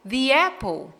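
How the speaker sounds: "the apple" as "thee apple"; long ee in "the" before "apple". Shown as a demonstration. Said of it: "essa pronúncia quando o the vem antes".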